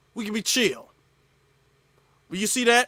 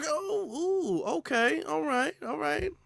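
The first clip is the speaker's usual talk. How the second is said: higher, softer placating; mock backing off; 7 semitones higher, 6 dB quieter, slower than usual. Streamer acting someone placating an upset man, high and soft. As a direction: back away, hands up, in falsetto. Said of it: "They get bothered".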